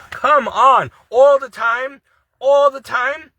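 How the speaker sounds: loud, high, disbelieving protest; exasperated outburst. Real, not acted. Vlogger venting to viewers about lost samples, loud exasperated protest. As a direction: throw up your hands and demand an answer. Ranting at repeated lab errors.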